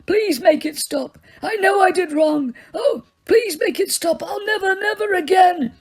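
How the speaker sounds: high, crying plea; old man pleading through tears; tormented begging. Acted. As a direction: beg for mercy in anguish.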